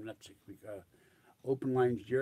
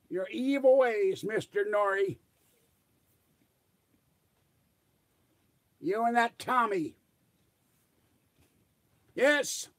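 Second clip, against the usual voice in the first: high, loud blustering self-styled general; prank call as J C; 11 semitones higher, 6 dB louder than usual. Pretend. Prank caller to radio host, high pompous general voice. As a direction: puff up as a pompous ten-star general prank-calling. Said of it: "call up as J C".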